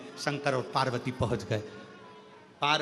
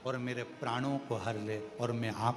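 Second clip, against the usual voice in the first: lower, soft humble plea; pleading demon Tarkasur; 7 semitones lower, 4 dB quieter than usual. Acted. Tarkasur begging Shiva for death and release, soft and humble. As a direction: beg the god for release, softly and lower.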